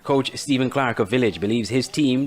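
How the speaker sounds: ordinary delivery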